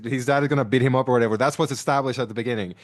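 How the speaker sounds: normal talking voice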